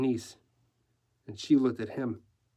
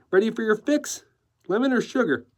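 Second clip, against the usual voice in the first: put-on high-pitched, very American young woman; perky young niece; 9 semitones higher, 4 dB louder than usual. Niece offering tea to newsman, perky.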